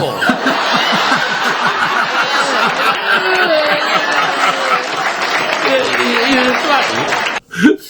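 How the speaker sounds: long rolling laugh at his own punchline. Real, not acted. Old joker laughing at his own punchline, laughing. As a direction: let the laugh run on.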